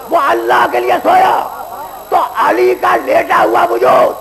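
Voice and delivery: full-force preaching. Acted. Preacher warns the young men about judgement day, in full-force preaching. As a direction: thunder it from the pulpit. Preacher repeats climax over music.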